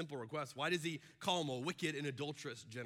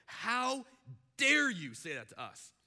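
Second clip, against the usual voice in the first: indignant; 6 semitones higher, 5 dB louder, slower than usual. Retold or imitated. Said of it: "just imagine it going".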